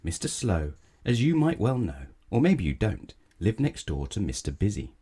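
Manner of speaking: plain reading voice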